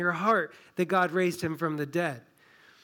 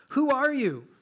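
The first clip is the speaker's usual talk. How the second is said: indignant challenge; 8 semitones higher, 5 dB louder than usual. Acted. Religious leaders challenge Jesus.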